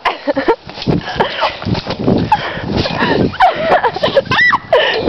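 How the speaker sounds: high crying laugh; shrieking laughter. Real, not acted. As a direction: laugh until it squeals. Hysterical laughter.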